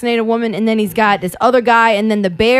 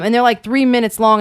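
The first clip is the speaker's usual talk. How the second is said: ordinary talk, nothing marked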